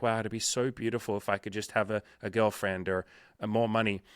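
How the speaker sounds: usual speaking voice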